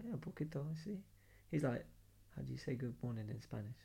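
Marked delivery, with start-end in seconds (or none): 0.0-1.0 hushed. Retold or imitated. Whispering back at the bar. 2.4-3.8 hushed aside at a bar. Retold or imitated. Whispering at the bar.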